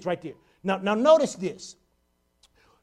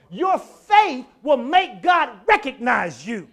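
emphatic preaching; 3 semitones higher, 10 dB louder, slower than usual. Real, not acted. Preacher drives the point home.

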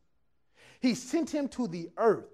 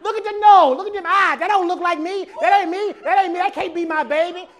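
higher, louder mock protest; hammed-up outraged denial of the baby; mock guest denial; 6 semitones higher, 9 dB louder than usual. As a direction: guest on Maury loudly denying the child. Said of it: "they all say the same thing".

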